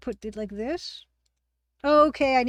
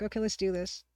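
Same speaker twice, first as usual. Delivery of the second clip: ordinary delivery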